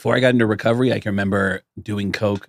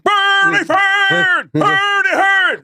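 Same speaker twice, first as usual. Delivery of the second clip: Swedish chef impression; 6 dB louder, much slower than usual. Friend imitates Slayer's singer as the Swedish chef, shouting gibberish. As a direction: yell nonsense like the Swedish chef. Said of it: "it's the Swedish chef".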